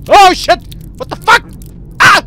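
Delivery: short, high, loud bursts of fright; startled yells. Acted. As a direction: act out a jolt of panic. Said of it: "este güey es como". (gritos agudos de susto)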